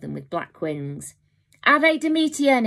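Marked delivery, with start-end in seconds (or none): 1.6-2.7 high, loud eerie talking-bird cry; a raven calling out like a person; spooky human bird voice. Acted. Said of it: "it cried in a spookily human voice".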